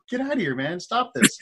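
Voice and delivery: high urgent yell; urgent shout. Retold or imitated. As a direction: order him to stop. Breaking up a fight.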